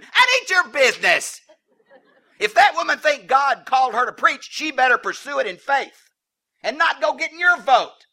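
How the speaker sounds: high, loud shouted admonition; heated preaching rebuke. Real, not acted. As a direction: thunder it at the doubters from the pulpit. Heated rebuke of imagined objector.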